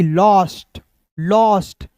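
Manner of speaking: लॉस्ट with a rounded open o, not लास्ट; लॉस्ट read as in cloth, never as लास्ट; model pronunciation. Shown as a demonstration. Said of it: "यहाँ पे लास्ट नहीं पढ़ेंगे".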